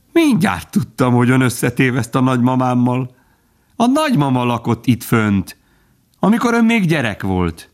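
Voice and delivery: high, excited squirrel voice; chatty squirrel. A narrator's reading. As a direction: prattle on eagerly with leaps up in pitch. (csacsogó, magas hangú mókus)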